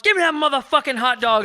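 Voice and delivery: rowdy shout. Retold or imitated. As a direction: grab for food like a drunk party kid. Drunk kids grabbing food.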